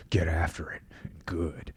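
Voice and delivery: low, whispered drill-sergeant murmur; Jocko Willink. Retold or imitated. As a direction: hush it like a calm Navy seal giving orders. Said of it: "and he talks like this".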